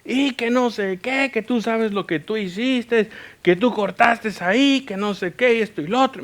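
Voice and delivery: high-pitched mock rant from an angry driver; retold angry ranting. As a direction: imitate an irate man's tirade. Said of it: "me empieza a gritar".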